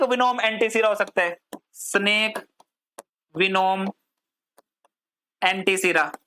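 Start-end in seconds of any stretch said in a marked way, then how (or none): 1.7-6.1 one word at a time, long pauses; slow dictation. Shown as a demonstration. Teacher giving students a heading to write, paused dictation. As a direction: dictate it word by word, leaving writing gaps.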